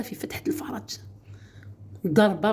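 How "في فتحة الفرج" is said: discreet whisper. Real, not acted. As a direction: whisper the delicate word. Discreet mention of intimate body part. (همس متحفظ)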